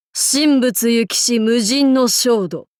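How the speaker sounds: ordinary delivery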